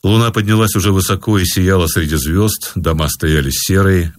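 plain reading voice

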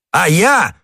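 high, hot-headed outburst; heated exclamation. A narrator's reading. Gascon cutting back at the stranger, heated exclamation. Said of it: "воскликнул Д'Артаньян".

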